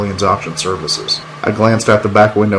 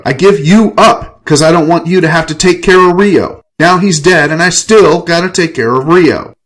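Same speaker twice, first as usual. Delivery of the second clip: higher-pitched, heated complaint; exasperated outburst; 7 semitones higher than usual. Acted. Enrique venting to Ava about Rio, exasperated. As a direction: pour out years of resentment. Narrator erupts about caring for brother.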